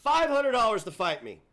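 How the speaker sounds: high, loud call-out; shouted challenge. Real, not acted. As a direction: throw down the challenge at full volume. Calls out a fight challenge.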